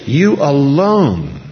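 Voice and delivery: "You alone" very slow, high and loud; emphatic you alone. Shown as a demonstration. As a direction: hold each word of "You alone".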